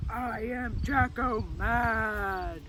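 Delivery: slow, hammed-up mad pumpkin; pretend angry voice. Shown as a demonstration. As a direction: drag out each word in mock fury. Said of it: "You say that in an angry voice".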